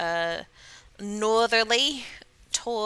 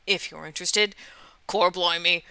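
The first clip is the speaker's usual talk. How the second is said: usual speaking voice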